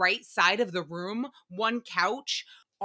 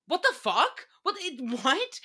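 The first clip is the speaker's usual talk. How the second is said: baffled disbelief; 6 semitones higher than usual. Real, not acted. Baffled at teaser.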